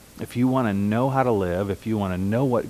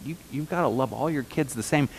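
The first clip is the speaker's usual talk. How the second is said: normal talking voice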